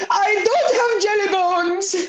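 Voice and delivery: high, slow, sobbing yell; hammed-up crying cartoon shout. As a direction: wail it through mock tears. Acting out show character scene.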